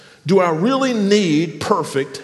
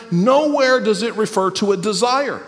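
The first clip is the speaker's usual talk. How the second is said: ordinary talk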